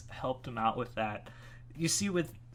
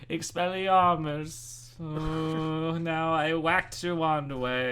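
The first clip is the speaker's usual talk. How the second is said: slow, drawn-out bored spellcasting; Cassius, bored; 4 semitones higher, slower than usual. Acted. Bored wizard disarming an opponent, slow and unimpressed. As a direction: stretch the words out with lazy disinterest. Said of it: "He'd be, like, bored".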